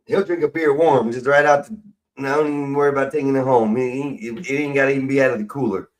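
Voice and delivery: ordinary talk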